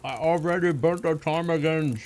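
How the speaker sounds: talking while chewing; words through a mouthful of food; mouth full. Real, not acted. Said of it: "I shouldn't talk with my mouth full".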